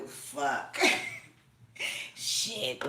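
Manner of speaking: normal talking voice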